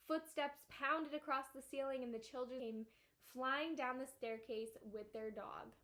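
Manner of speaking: ordinary delivery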